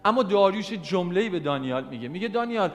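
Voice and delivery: loud, high, fervent preaching; preaching at full force. Acted. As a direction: drive the message home to the congregation.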